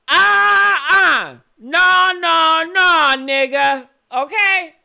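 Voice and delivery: loud, furious denial; angry shout. Real, not acted. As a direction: explode at him, shouting no. Angry outburst at interviewee.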